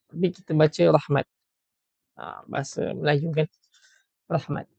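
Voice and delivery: ordinary talk, nothing marked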